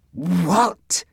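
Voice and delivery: high, rising disbelief; shocked exclamation. A narrator's reading. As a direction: exclaim it in astonishment, pitch climbing. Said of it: "exclaimed Jack".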